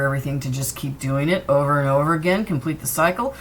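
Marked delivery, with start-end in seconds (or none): none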